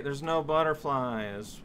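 usual speaking voice

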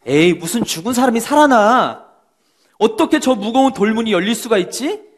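mock the very idea; scoffing disbelief. Doubting people scoffing at the resurrection news. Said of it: "사람들은".

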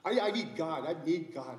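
acted urgent need, stammered start; urgent pleading. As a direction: plead earnestly, starting loud and easing. Patient pleading to pray, acted.

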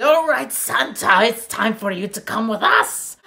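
menacing order in a put-on guard voice; orc guard. Orc dwarfs arresting Santa, loud and menacing.

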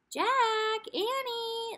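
high, loud call from far away. A narrator's reading. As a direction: shout their names across the yard. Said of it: "their mom called again".

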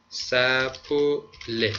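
very slow, split into separate syllables. Shown as a demonstration. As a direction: model the word slowly for learners. Teacher models new verb syllable by syllable.

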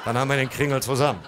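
excited dad promising his son; triumphant. Retold or imitated. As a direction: rush it out in triumph.

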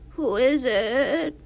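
slow, cackly wolf posing as Granny. Acted. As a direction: cackle it out like a wolf playing old lady. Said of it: "in a cackly voice".

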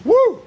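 short, high "woo"; playful high whoop of celebration; whooped cheer. Pretend. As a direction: let out a quick high-pitched whoop. Celebrating the audience's full potential.

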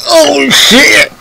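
replaying a stunned yell while watching with dad; shocked outburst. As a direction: relive the shock of seeing it happen, yelling. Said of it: "we're like".

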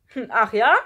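loud, scornful comeback; loud schoolboy dare; challenging retort. A narrator's reading. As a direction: call back as a challenge. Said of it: "rief Kai zurück".

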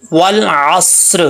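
"वल असर" drawn out slowly with a full, heavy स्वाद; Quranic recitation demo. Shown as a demonstration. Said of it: "तो कैसे पढ़ेंगे". ("वल असर" को धीरे, खींचकर, मोटे स्वाद से पढ़ें)

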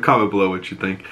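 normal talking voice

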